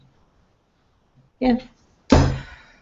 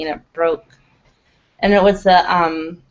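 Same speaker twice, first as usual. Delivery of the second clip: ordinary talk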